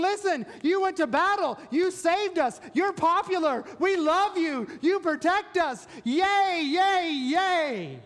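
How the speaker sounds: high, eager mock praise; hammed-up cheering for the king; praising crowd. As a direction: crowd hailing the king after battle. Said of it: "they were really praising the king".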